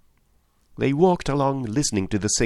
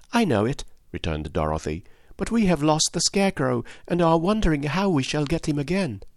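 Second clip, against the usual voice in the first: put-on a higher, light girlish voice; lightly voiced young girl; 7 semitones higher than usual. A narrator's reading. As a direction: introduce yourself and friends brightly, a hint of a girl.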